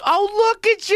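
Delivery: loud, high-pitched gushing exclamation; mock-delighted high, loud coo; gushing mother-in-law. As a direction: squeal it like a doting relative. Acts out mother-in-law grabbing face.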